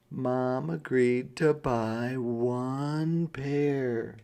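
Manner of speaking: plain reading voice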